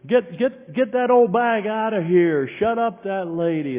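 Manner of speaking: high, loud jeering shout; angry crowd yell. Retold or imitated. Audience turns on the woman, loud angry yelling. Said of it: "And they yelled".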